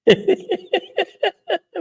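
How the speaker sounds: high, drawn-out giggling laugh; real laughter. Real, not acted. Host reading the general's threats can't help it, real giggling. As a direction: break into a helpless high giggle. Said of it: "मुझे तो हँसी आ रही है".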